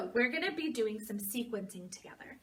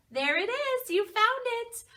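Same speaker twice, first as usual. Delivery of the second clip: high, delighted cheer; excited praise; 10 semitones higher, 5 dB louder than usual. Pretend. Teacher to child who found the brown bear, excited praise. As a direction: celebrate the child's find, pitched high.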